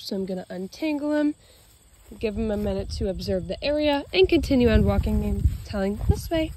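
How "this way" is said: higher, sing-song call to the dog; dog command. Real, not acted. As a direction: call him along cheerfully. Calling the dog to turn.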